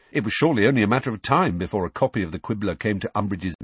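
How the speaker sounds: plain reading voice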